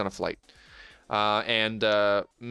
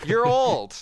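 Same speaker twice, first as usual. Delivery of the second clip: high, loud mock taunt of "old"; teasing shout; 12 semitones higher, 6 dB louder than usual. Teasing the birthday guest, a loud high mock jeer. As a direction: yell it like a playground tease. Teasing friend about his age.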